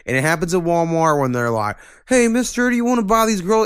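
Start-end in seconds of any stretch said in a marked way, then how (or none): 2.1-3.7 put-on squeaky little kid pitch, much higher; mock high kid voice. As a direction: imitate an eager kid asking a stranger. Retelling Walmart girl-scout pitch.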